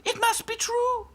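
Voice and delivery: very high, slow, alarmed voice; awed princess doll. A narrator's reading. Princess dolls believing the wizard story, alarmed. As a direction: draw it out in high-pitched dismay.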